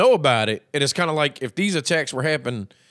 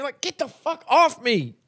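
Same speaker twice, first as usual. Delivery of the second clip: higher, slow outraged recoil; mock disgusted; 9 semitones higher, slower than usual. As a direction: push him off in a pitched-up voice. Recoiling from the hunter.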